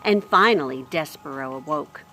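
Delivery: expressive storybook reading; lively read-aloud voice; story narration. A narrator's reading. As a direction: read it like a bedtime chapter. Reads chapter aloud to children.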